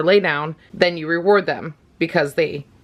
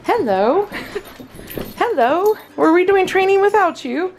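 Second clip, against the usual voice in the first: excited greeting; 11 semitones higher than usual. Real, not acted. Greeting the dogs who came in, high excited pet talk.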